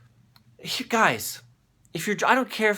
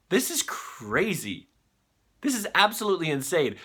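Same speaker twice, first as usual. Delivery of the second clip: usual speaking voice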